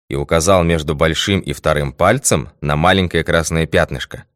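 ordinary delivery

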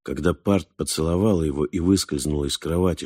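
usual speaking voice

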